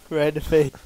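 normal talking voice

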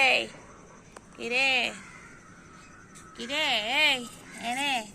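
loud sharp call. Real, not acted. Owner loudly calls the boyfriend cat. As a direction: call the pet over, loud.

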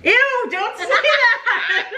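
high squealing ew; grossed-out giggle; laughing disgust. Real, not acted. Grossed out by wet comment.

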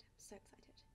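hushed thrill; whispered excitement. Real, not acted. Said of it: "whispered excitement".

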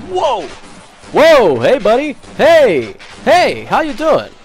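mock friendly shout. Real, not acted. Hailing an enemy mockingly.

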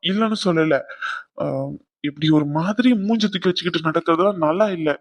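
ordinary talk

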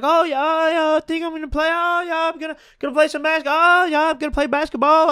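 very high, fast, giddy babbling voice; manic rambling friend. Retold or imitated. Imitating a high friend rambling nonsense, in a squeaky manic voice. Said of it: "this guy was having, like, a manic episode".

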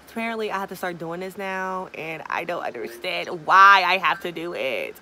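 loud annoyed complaint. Real, not acted. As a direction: grumble loudly about the rule. Forced new store step.

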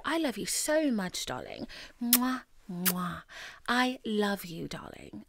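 signature sign-off. Acted. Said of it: "As I always say".

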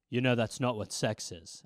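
low, soft serious father; stern dad. Retold or imitated. Dad pulling his son aside, low and soft. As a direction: drop low like a dad about to lecture.

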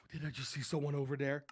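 quick, low, hushed question; half to himself, suddenly uneasy; fast nervous murmur; hushed and spooked. Real, not acted. Spooked player spots a stranger.

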